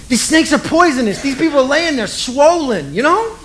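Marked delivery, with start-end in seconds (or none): none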